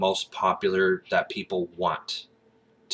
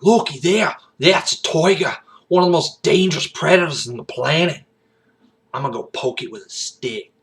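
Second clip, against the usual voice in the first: high, loud Aussie wildlife-host impression; big Australian accent, hyping up a dangerous animal; Steve Irwin; 9 semitones higher, 7 dB louder than usual. Wildlife host showing viewers a tiger, excited Aussie accent. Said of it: "Steve Irwin bit".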